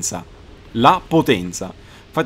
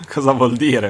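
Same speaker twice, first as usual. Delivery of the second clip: ordinary talk, nothing marked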